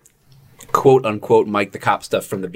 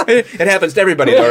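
laughing; 11 semitones higher, 5 dB louder, faster than usual. Real, not acted. Laughing through joke about flop.

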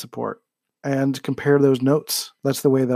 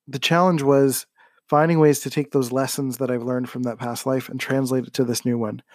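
normal talking voice

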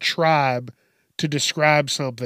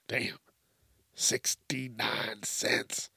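slow breathy whisper under the breath; whispered aside; 6 semitones lower, 11 dB quieter, much slower than usual. Real, not acted. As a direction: whisper it to yourself, slowly. Muttering over gas price.

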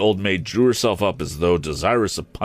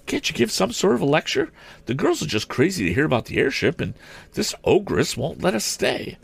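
quick, high-pitched schoolgirl pleading; urgent appeal; 11 semitones higher than usual. A narrator's reading. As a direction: press him in a high, hurried girlish voice. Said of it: "appealed Mary Nestor in a whisper".

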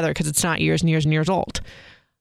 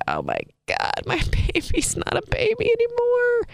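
mock dramatic; 14 semitones higher than usual. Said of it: "I sound like Encyclopedia Dramatica right now".

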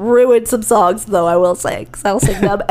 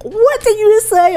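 much higher, stunned disbelief; shocked sound guy; 10 semitones higher than usual. Retold or imitated. Sound guy overhearing a crude joke, shocked outcry. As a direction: blurt it out, caught off guard.

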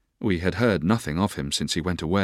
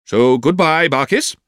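high, loud, emphatic goodbye; older relative's raised-voice send-off; aunt's farewell; 8 semitones higher, 7 dB louder, slower than usual. A narrator's reading. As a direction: call out a ringing, high-pitched farewell. Aunt's farewell to servant, acted.

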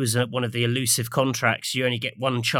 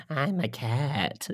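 slow, drawn-out cat-person voice; 3 semitones higher, 4 dB quieter, much slower than usual. Acted. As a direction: stretch it out like a talking cat. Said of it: "how all the cat people are like".

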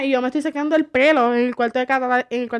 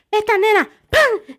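higher, louder exasperated mother; mock scolding mother; 6 semitones higher, 4 dB louder, slower than usual. Mother scolding her daughter at home, exasperated.

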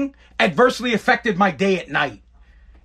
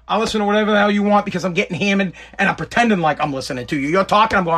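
ordinary talk, nothing marked

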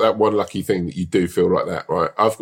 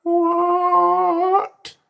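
much higher, drawn-out rising squeak; mock surprise. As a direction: play sudden curious shock. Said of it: "another bit of you would go".

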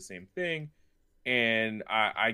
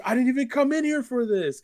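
imitating a fan, much higher and louder, giddy disbelief; freaking out; 5 dB louder than usual. Young fan finds a wrestling figure by chance, high giddy gush. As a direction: gush it like a kid who can't believe his luck. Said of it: "He's just freaking out".

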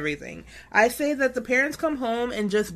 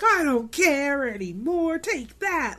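higher, slower hammed-up rant; mock tirade; 5 semitones higher, slower than usual. Nick Cage throwing stuff, hammed-up tirade. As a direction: throw it like a tantrum. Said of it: "and he's like".

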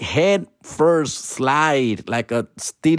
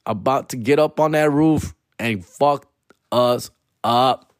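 ordinary delivery

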